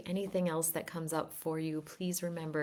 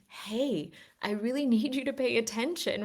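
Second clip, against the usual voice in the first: voicing the body; 6 semitones higher than usual. The body calling out to its owner, quick and high. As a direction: speak as the body begging to be heard. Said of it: "It's your body saying".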